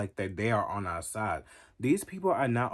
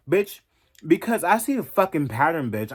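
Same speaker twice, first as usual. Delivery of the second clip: a much higher, louder snap of "bitch"; angry shout; 7 semitones higher, 9 dB louder than usual. Real, not acted. Vlogger yelling back at critics, loud and angry. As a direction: shout it right back at them.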